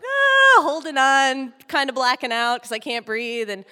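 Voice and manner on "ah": high loud panicked yelp; my own scream. Retold or imitated. Kid being dragged down the street, yelping loudly. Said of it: "I'm just like".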